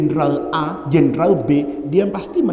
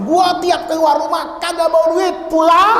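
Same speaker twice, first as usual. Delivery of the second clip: boastful husband; 8 semitones higher than usual. Retold or imitated. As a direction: brag to the wife in a raised voice. Preacher's comic retort to wife. (suami menyombong, nada tinggi)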